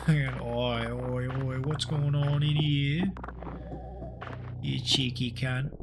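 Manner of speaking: slow, drawn-out mock Australian bloke; Australian accent. Gamer asks for an Australian accent, hammy slow Aussie voice. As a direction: ham up an Aussie larrikin catching someone out. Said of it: "give me an Australian accent".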